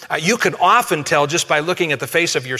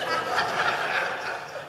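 soft breathy laugh; 9 dB quieter than usual. Real, not acted. Laughs at own joke.

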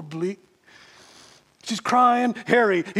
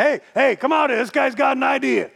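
calling loudly into the back, higher; yelling; 6 semitones higher, 6 dB louder than usual. Retold or imitated. Diner owner calling his wife from the back, loud shout. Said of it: "He yells in the back".

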